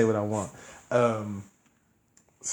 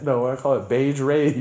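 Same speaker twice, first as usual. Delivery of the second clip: laughing punchline; 3 semitones higher, 5 dB louder than usual. Real, not acted.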